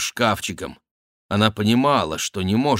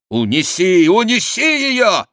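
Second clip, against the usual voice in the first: urgent order climbing very high; shout; 9 semitones higher, 5 dB louder than usual. A narrator's reading. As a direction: yell it, the repeat higher still. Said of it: "крикнул Бюсси". (отчаянный громкий крик, всё выше)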